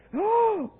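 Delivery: big, high, loud gasp; sharp theatrical intake of breath; shocked gasp. Retold or imitated. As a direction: gasp in mock horror. Horrified reaction to lady's openness.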